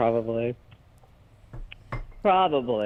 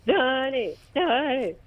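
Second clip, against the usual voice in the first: high calling; 12 semitones higher, slower than usual. Pretend.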